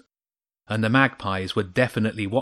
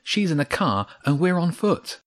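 higher, anxious voice; mildly fretful, pitched up; worried; 7 semitones higher than usual. A narrator's reading. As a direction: protest with worry in the voice. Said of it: "worry sounding clearly in her voice".

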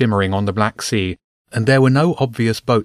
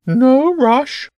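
very high, slow pigeon voice; bishop bird; 6 dB louder than usual. Acted. Bishop bird guides James's quill, high bird voice. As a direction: coach slowly in a loud, high bird voice.

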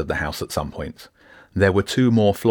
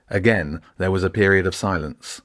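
plain reading voice

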